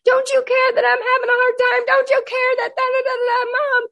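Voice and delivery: put-on high, whining teenage complaint; mock the daughter's wail, way up high; whiny teen daughter. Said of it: "And then she".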